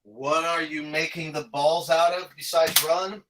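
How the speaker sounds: usual speaking voice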